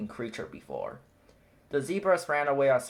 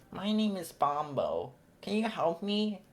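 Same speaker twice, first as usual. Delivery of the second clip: hint of baby elephant; 7 semitones higher, 3 dB quieter than usual. A narrator's reading. Bombo's voice.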